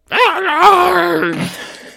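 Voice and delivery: high, loud, strangled howl; drawn-out shriek of pain; agonized scream. Acted. Quirrell's pain as face is grabbed.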